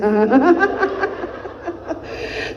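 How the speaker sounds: drawn-out laugh. Real, not acted. Preacher laughing at her own anecdote to the congregation, laughing. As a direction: break into a long laugh.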